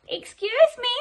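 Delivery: high, loud little cricket voice; polite cricket. Acted. Cricket interrupting Gerald in the clearing, high polite voice. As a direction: pipe up politely like a tiny insect. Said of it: "coughed a cricket".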